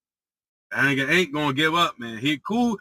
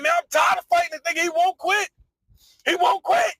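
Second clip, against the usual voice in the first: much higher, whiny plea to stop fighting; exhausted pleading. Retold or imitated. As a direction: beg like you can't throw another punch.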